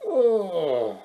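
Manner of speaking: sleepy yawn. Acted. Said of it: "yawning and rubbing her eyes".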